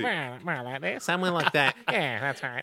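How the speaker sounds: lighter Andy voice, not as low; a mild take on Andy; Andy impression. Shown as a demonstration. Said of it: "a little bit too low for Andy".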